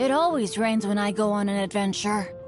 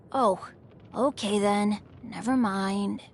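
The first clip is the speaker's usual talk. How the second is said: mildly disappointed; 3 semitones lower, slower than usual. Acted. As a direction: let down, sinking slightly. Invitation turned down, he gives up.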